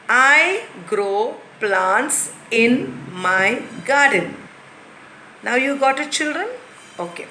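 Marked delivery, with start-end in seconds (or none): 0.1-4.4 very slow, clear model reading; slow model sentence. Shown as a demonstration. Teacher slowly reads the corrected sentence. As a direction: give the fixed sentence slowly. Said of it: "corrected version of jumble".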